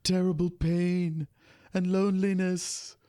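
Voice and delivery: Troi's mysterious accent quoted mockingly; Troi's put-on accent. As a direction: ham up Troi's exotic accent on each word. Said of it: "she was putting on this accent".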